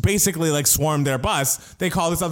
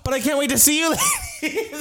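very high singsong sign-off breaking into laughter; high-pitched, giggly imitated glee; mock gleeful quote. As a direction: imitate a gushing housewife, laughing at the end. Mimics housewife's gleeful sign-off.

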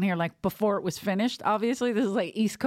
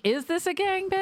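high mock question; 11 semitones higher than usual. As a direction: question pushed high, squeaky. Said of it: "I'm like".

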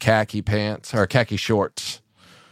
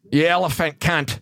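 put-on Australian accent; 5 semitones higher than usual. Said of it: "Australian accent".

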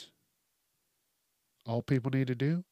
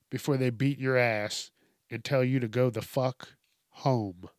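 normal talking voice

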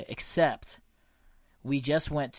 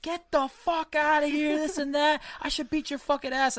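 imitating an angry guy's high, loud yell; high-pitched mock rant, loud and aggressive; pissed-off dude; 12 semitones higher, 3 dB louder than usual. Angry stranger chasing off photographers, pissed-off imitation. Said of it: "this dude was so pissed".

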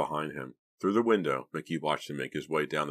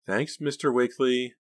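higher-pitched uneasy thanks; 8 semitones higher, 4 dB louder than usual. A narrator's reading. Pam thanks her friend's father, uneasy. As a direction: thank him with a touch of unease. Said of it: "Pam said uneasily".

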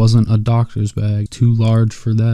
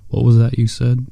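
usual speaking voice

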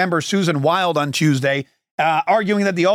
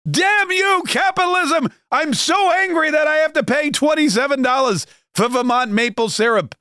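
much higher mock outrage over the price; hammed-up old-man fury at syrup; high exaggerated Bernie impression; Bernie Sanders outrage; 9 semitones higher than usual. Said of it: "he goes".

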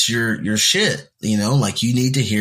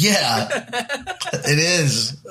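laughing, drawn out; 8 semitones higher, much slower than usual. Real, not acted. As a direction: laugh through it. Laughing at his friend.

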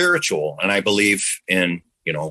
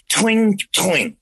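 sound effect twing; 9 semitones higher than usual. Retold or imitated. Memories spit out, sound effect.

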